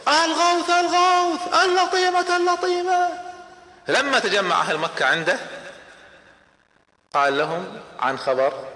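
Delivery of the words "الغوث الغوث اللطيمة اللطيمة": desperate scream. Acted. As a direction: scream it out like a man raising the alarm. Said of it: "بدأ يصرخ". (صراخ استغاثة عالٍ)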